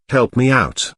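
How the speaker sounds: a lightly voiced, weary plea; begging. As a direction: ask for help like an exhausted boy, understated. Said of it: "he continued to beg".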